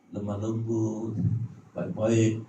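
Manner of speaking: soft, slow, low and mild; gentle and meek. Acted. Mimics the imagined gentle Jesus.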